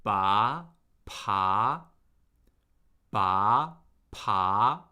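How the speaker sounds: "Ba" and "pa" starting low and rising; second tone. Shown as a demonstration. Teacher drilling the second tone, rising pitch on "Ba, pa". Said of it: "let's do the second tone with ba and pa".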